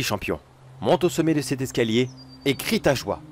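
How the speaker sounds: ordinary delivery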